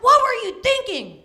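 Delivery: mock angry shout. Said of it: "He's like".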